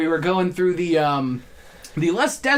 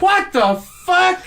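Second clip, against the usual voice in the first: high, loud and slow, drawn out in disbelief; mock disbelief; 12 semitones higher, 5 dB louder, slower than usual. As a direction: stretch it out, baffled. Retold disbelief at third bear.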